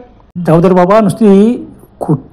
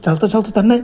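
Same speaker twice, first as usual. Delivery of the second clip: plain reading voice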